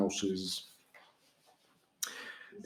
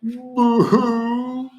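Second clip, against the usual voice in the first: higher, louder hammed-up fake crying; mock the Whos' wailing; mock boo-hoo; 10 semitones higher, 7 dB louder than usual. A narrator's reading. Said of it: "will all cry".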